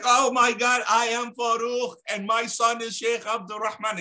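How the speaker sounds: high, elated cry of a proud father; overjoyed shouting. Retold or imitated. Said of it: "he's shouting and he's shouting".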